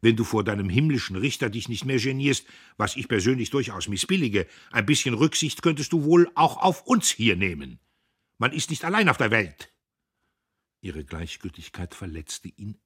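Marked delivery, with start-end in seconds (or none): 0.0-9.7 louder, higher scolding; indignant brother lecturing his sister; indignant lecture. A narrator's reading. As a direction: scold her self-righteously. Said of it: "Diederich empörte sich".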